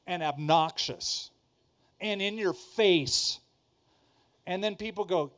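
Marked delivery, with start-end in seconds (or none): none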